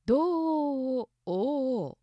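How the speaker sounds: 東欧をおお stretched very slowly, every お held and marked; 東欧をおお as one long sung-out o-chain; drawn-out vowels. Shown as a demonstration. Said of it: "同じ音が続く一番長い".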